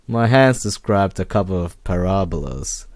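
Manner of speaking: usual speaking voice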